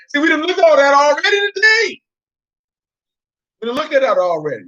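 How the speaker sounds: a high-pitched exasperated yell; exasperated shout. Real, not acted. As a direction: shout it at the class like they should know. Exasperated reminder to class.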